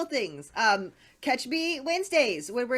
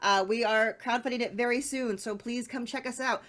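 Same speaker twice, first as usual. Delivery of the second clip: normal talking voice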